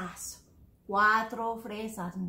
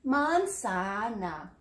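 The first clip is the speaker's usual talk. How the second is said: "manzana" slowly, each syllable drawn out; "manzana" in three long, separate syllables; slow demonstration; 5 semitones lower, much slower than usual. Shown as a demonstration. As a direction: stretch "manzana" out for learners to copy. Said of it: "it's a little bit harder".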